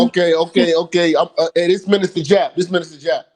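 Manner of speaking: loud, insistent interruption; loud calling out. Real, not acted. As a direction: cut in loudly to grab the floor. Jumping in to interrupt loudly.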